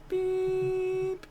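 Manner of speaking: long, very high held beep; imitated render beep. Imitating the render's beep to his viewers, high and held. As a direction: mimic the machine's error tone. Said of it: "the render goes like this".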